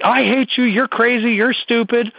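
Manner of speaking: put-on high, whiny teenage yell; mock teen outburst. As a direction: play the angry teen lashing out. Parent voicing teen's outburst.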